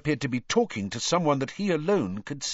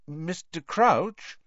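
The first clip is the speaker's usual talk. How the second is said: higher, drawn-out tentative call; slow, questioning, careful address; cautious; 7 semitones higher, much slower than usual. A narrator's reading. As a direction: cautiously try to get his attention. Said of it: "said Harry cautiously".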